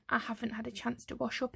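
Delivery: softly blurted excuse. A narrator's reading. Arthur repeats his father's line to the visitor, softly blurted. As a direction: let it slip out, hushed. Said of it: "he blurted".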